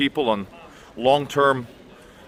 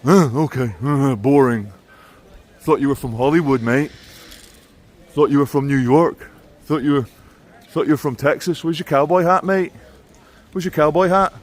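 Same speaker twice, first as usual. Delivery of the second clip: disappointed local; 4 semitones higher than usual. Retold or imitated. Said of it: "And they're like".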